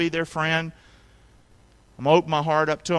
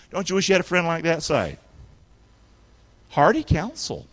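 normal talking voice

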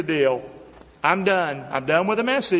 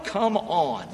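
ordinary talk, nothing marked

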